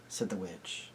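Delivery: ordinary delivery, nothing marked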